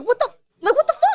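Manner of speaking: high-pitched stunned reaction; shocked disbelief. Retold or imitated. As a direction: squeak it out in disbelief.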